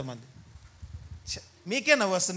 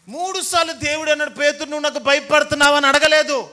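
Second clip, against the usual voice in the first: emphatic preaching; 5 semitones higher, 6 dB louder than usual. Real, not acted. Preacher stressing Jesus's question.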